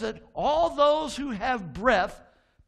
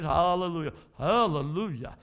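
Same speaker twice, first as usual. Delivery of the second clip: Southern accent; 3 semitones lower than usual. Shown as a demonstration. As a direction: say hallelujah with a Southern twang. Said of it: "You put that southern accent in".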